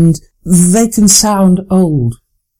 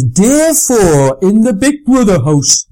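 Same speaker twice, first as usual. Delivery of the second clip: put-on broad Geordie accent, raised and slowed; Marcus's Geordie accent; 5 semitones higher, slower than usual. Announcer imitating her former trainee's Big Brother line, broad Geordie accent. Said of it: "very broad and lovely Geordie accent".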